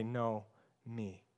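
quiet mocking refusal. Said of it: "how many times do we sit there and say".

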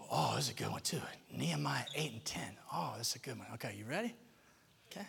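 hushed aside to the volunteer; quiet whispered aside. Real, not acted. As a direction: drop to a whisper.

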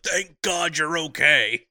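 loud, slow, overwrought relief; Mister Wilson. Retold or imitated. Imitating the neighbor greeting the returned boy, exaggerated relief. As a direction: draw it out in hammed-up relief. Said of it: "Mister Wilson is like".

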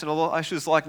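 ordinary talk

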